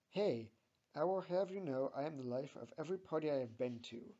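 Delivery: brisk, indignant retort; arguing. A narrator's reading. Bob defends himself as a party animal, arguing. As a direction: protest quickly and firmly. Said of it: "argues Bob".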